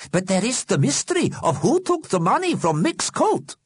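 very high character voice; intrigued viewer. A narrator's reading. Solomon Einstein puzzles over the soap mystery, intrigued. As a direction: protest that the plot is unresolved. Character wants programme to continue.

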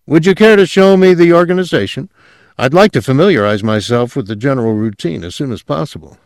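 brisk, keen request with apparent sincerity; lightly acted earnest politeness, quick; sincere. A narrator's reading. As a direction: ask quickly and earnestly, as an eager newcomer. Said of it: "With every evidence of sincerity, he asked".

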